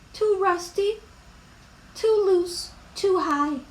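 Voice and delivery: high little worrier's voice; acted childlike worry; anxious worrying. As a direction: fret in a small, high character voice. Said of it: "Wemberly worried about the chains".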